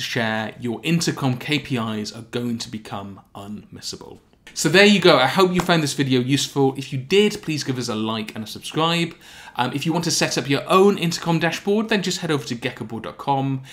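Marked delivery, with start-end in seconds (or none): none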